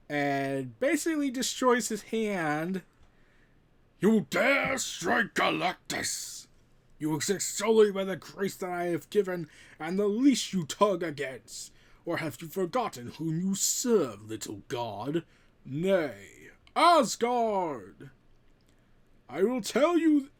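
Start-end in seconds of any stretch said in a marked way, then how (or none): 4.0-18.1 booming cosmic tyrant. Acted. Galactus confronting Thor. 19.3-20.3 higher and louder, defiant cry; defiant shout. Acted. Thor defying Galactus.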